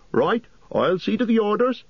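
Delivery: very high, bustling landlord voice; high-pitched, willing landlord; obliging innkeeper. A narrator's reading. Innkeeper figure answers briskly.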